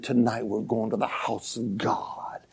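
slow, breathy resolve; hushed whisper. Retold or imitated. Parents quietly deciding to bring their son, whispered. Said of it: "that mom and dad said".

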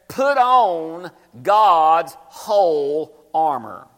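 very slow, weighty verse reading; slow scripture reading. Acted. Preacher reading the verse slowly to the church. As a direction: drag each word of the verse out.

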